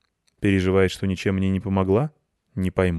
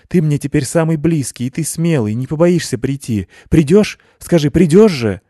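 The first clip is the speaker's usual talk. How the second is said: higher and louder, begging him to promise; urgent pleading; 8 semitones higher, 7 dB louder than usual. A narrator's reading. Girl begs him to promise he'll come, urgent pleading. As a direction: press him, pleading, voice climbing.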